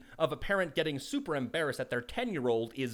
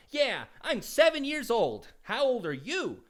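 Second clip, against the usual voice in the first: put-on high, slow, loud child voice; seven-year-old telling the clerk his age, high and slow; proud little kid; 9 semitones higher, 4 dB louder, slower than usual. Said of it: "the little kid was like".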